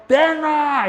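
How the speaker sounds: very high, loud defiant yell from the gut; bold challenging shout. Shown as a demonstration. Teacher demoing how the gallbladder meridian gives courage, shouting. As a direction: puff yourself up and shout them down. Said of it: "이러는 거예요".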